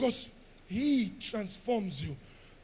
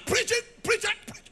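mock cheering fan; 10 semitones higher, faster than usual. Crowd cheering the eloquent preacher, mock excited.

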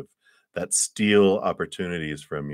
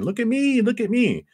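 much higher, whiny look at me; attention-seeking kid. Retold or imitated. Fears begging to be noticed, high childish whine. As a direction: plead for attention like a small child. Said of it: "make themselves known, going".

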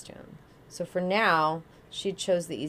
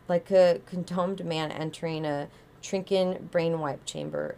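ordinary delivery

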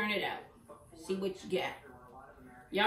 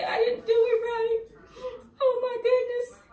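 high, loud hammed-up wail of frustration; mock crying; 13 semitones higher, 5 dB louder than usual. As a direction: wail it like a crafter who botched the job. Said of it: "Do not get mad at yourself and say".

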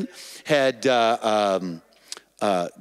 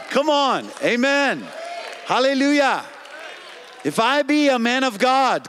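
full-force preaching; 9 semitones higher than usual. Real, not acted. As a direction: rouse the congregation to shout back. Preacher's climax to the congregation.

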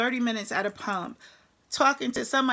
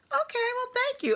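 much higher, awkward and surprised; flustered thanks. Retold or imitated. Trucker thanking a stranger who paid her meal, high flustered. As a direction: squeak out a startled thank you.

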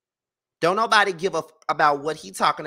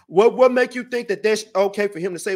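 lightly put-on voice of an indignant fan, louder; mocking his defenders; 3 semitones higher, 6 dB louder than usual. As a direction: mimic the people sticking up for him. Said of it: "and then talking about something".

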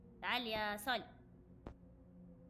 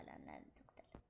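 urgent whisper; 19 dB quieter than usual. Real, not acted. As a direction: whisper it sharply. Whispered warning in game.

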